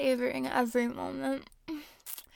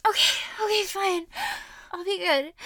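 higher, louder surrender; hasty giving in; 5 semitones higher, 4 dB louder than usual. Acted. Woman yields to her partner, higher-pitched giving in. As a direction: give in with the pitch raised.

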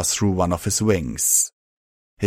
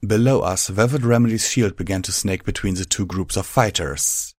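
ordinary delivery

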